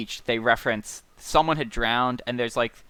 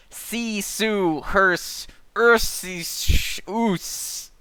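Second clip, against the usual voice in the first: higher, hissing wave sounds; wave speech; 8 semitones higher, much slower than usual. Acted. Reading the sea's speech aloud to co-hosts, high imitated wave sounds. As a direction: sound out each word apart, like surf. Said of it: "a four-worded wave speech".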